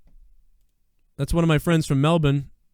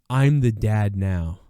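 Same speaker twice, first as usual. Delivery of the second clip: mock smug new boyfriend; 6 semitones lower, slower than usual. Retold boyfriend's line.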